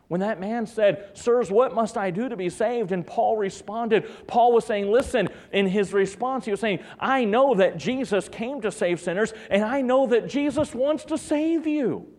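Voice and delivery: high-pitched, insistent sermon delivery; fervent preaching. Real, not acted. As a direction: build to a high, ringing declaration. Preaches Paul's answer.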